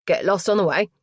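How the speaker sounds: put-on punchy London-accented banter; strong London accent. A narrator's reading. Mortuary lad ribs late visitors, strong London accent. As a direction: tease them in broad London vowels. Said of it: "he said in a strong London accent".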